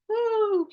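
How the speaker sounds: mock ego whoop. Ego feeling the love.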